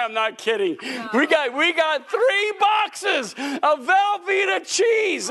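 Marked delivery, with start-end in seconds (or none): 1.1-5.3 incredulous laughing exclaim. Real, not acted. Preacher to congregation, recalling the cheese offering, high laughing disbelief. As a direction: cry it out half laughing, amazed.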